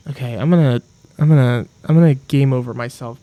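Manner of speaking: loud, strained frustrated outburst; furious gamer at a fishing minigame; raging. Real, not acted. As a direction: blow up at the game. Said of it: "So much rage for one person".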